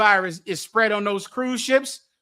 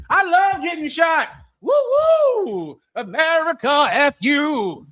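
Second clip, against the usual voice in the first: mock cheer; 6 semitones higher, slower than usual. Mocking gung-ho cheer.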